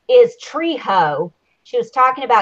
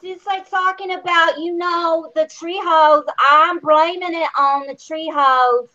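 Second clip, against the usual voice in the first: mimicking her accent, pitched up; higher-pitched mock accent of a housemate; Rockstar; 5 semitones higher than usual. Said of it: "it's the accent, y'all".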